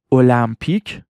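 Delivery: المپیک said the Persian way, "olampik"; Persian pronunciation. Shown as a demonstration. Teacher shows how Persian speakers say it, Persian pronunciation. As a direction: say المپیک as Persian "o-lam-pik".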